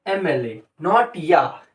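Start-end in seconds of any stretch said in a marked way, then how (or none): 0.0-0.6 "एम एल ए" opening on the vowel ए; starts with an "e" sound. Shown as a demonstration. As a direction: say "एम एल ए" as em-el-e, vowel first. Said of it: "इसका pronunciation ए से हो रहा है".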